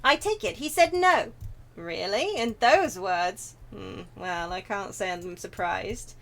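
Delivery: eloquent, theatrical gentleman, rising and falling; formal, drawn-out character voice; courtly wine lover. Acted. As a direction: play a refined character taking bad news. Reads a game character's dialogue.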